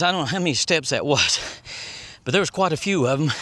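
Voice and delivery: usual speaking voice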